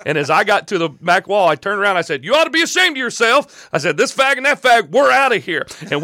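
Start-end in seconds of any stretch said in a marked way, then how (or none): 2.2-3.7 high, loud angry call-out; retold shout. Confronting preacher at back wall. 4.0-5.7 high, loud defiant shout; retold shout. Confronting preacher, walking out.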